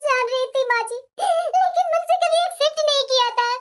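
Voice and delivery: weeping through the words; grieving, with sobbing breaths between phrases; tearful. Acted. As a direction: speak through tears of loss. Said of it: "तुम इस तरह से रोने गाने लगेगी".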